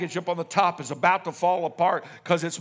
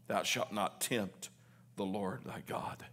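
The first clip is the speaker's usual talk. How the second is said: slow, dropping to a whisper; soft scripture, fading to breath; quiet firm answer to the tempter; hushed rebuke; 8 semitones lower, 12 dB quieter than usual. Acted. Jesus answering Satan, hushed.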